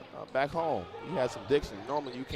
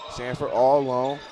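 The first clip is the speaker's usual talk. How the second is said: building louder play-by-play; hyped commentator; excited call; 4 semitones higher, 4 dB louder than usual. Real, not acted. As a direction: rise with the play as he gets open. Open shot developing, rising call.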